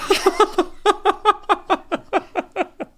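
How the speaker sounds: high shriek of laughter. Real, not acted. Hearing about her dating shock, shrieking laugh. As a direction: burst out in a squealing laugh.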